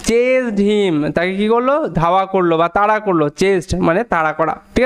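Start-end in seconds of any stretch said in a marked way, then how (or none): 0.1-1.1 slow demo read. Shown as a demonstration. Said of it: "উচ্চারণটা অ এর মতো হয়".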